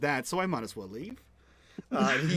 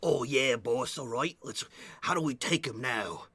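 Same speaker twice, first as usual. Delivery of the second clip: put-on Cockney accent; 3 semitones lower, 6 dB quieter, slower than usual. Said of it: "As [name] adopts his worst Cockney accent".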